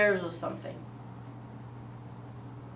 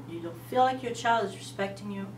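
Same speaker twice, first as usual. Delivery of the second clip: usual speaking voice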